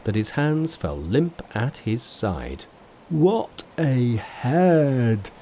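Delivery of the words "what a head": slow, stunned gasp of wonder; very slow amazed Pigsy; amazed exclamation. Acted. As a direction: marvel at the unhurt head. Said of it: "he exclaimed".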